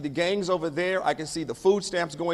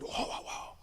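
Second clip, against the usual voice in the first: whispered, much softer exclamation; whispered whoa; 12 dB quieter than usual. Acted. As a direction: breathe out in awe. Unvoiced interjection.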